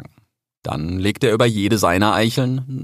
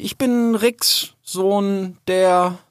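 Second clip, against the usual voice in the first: high, slow, halting introduction; unsure; 11 semitones higher, much slower than usual. A narrator's reading. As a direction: introduce yourself haltingly, losing your thread. (verunsichert, stockend)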